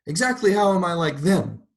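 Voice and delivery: high, loud indignant question; demanding. A narrator's reading. Mrs. Turpin pressing God for an answer, indignant. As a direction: press the point, offended. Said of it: "she demanded".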